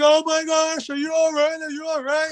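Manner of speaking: high, fluttering worried-mother voice; worried mom. Retold or imitated. Mom rushing in after her son's match, high and frantic. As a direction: squeal it like a panicked mom. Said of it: "my mom's like".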